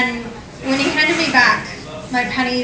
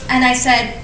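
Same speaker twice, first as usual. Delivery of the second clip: normal talking voice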